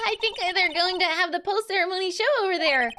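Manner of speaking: high, lightly voiced game sidekick; eager companion. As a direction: pitch it up for the sidekick.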